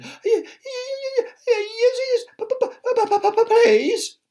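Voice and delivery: very high, shaky frightened stutter; quivering stammer. Acted. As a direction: stutter yes please in fear. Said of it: "quivered and shook and said".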